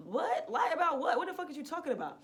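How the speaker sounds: high-pitched indignant disbelief; squawked, offended questioning; incredulous. Retold or imitated. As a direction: push back on a baffling accusation. Acted outrage at being accused.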